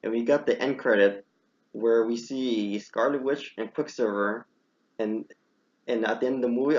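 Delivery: usual speaking voice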